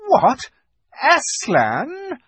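high, shocked exclamation; crying out. A narrator's reading. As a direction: cry it out in alarm, pitched very high. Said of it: "cried the Queen".

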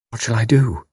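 small, lost and forlorn; forlorn question. A narrator's reading. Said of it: "A simple, forlorn question".

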